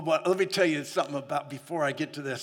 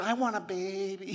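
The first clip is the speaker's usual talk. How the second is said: a touch higher, softer pleading; wife's wish, softly raised; lightly imitating wife; 4 semitones higher, 3 dB quieter than usual. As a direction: voice the wife wanting a baby. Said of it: "and she said".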